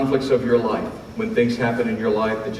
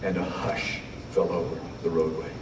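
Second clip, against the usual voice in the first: hushed; 5 semitones lower, 11 dB quieter than usual. Acted. Hush after climax, softened.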